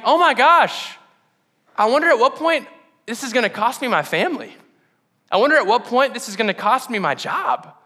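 a new husband's rising panic; anxious inner voice. Retold or imitated. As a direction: dread of losing your family. Retold fear entering marriage.

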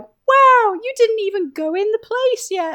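hammed-up astonishment from a villager; mock amazed NPC. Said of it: "they're like".

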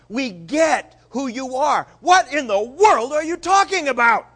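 loud, high, exasperated scolding; indignant rebuke. Retold or imitated. Peter rebuking Jesus, loud and high exasperation. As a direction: Peter telling Jesus off, shouting.

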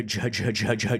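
ordinary delivery

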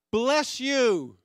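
loud, high cheery yell at drivers. Retold or imitated. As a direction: holler it out at a passing car. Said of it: "but we yell things like".

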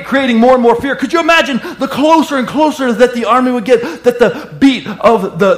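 high, loud preaching; preaching at full force. Acted. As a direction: drive the story home to the congregation. Preaching the Red Sea story.

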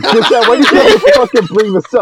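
half-laughing, half-yelling at a friend; laughing exasperation. Real, not acted. Mock outrage at co-host's joke.

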